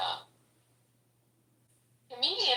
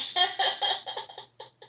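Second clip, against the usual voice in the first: high, airy giggling fit; laughter; 11 semitones higher than usual. Real, not acted. Friend tickled that she could see his profile, high laughter.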